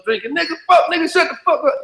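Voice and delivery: high, mock rowdy shout at the domino table; a sudden high hollering voice; rowdy yelling. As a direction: yell like the uncles slamming dominoes. Mimics rowdy uncle's house scene.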